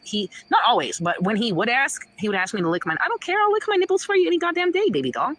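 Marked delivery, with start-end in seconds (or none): 3.0-5.3 high-pitched, eager retort; defiant acted reply. Woman answering her ex's request, defiant. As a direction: throw it out high, daring him.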